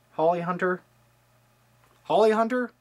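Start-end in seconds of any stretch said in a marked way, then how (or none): 2.0-2.7 questioning. Shown as a demonstration. Vlogger double-checking the actress name, unsure. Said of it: "Just go with me".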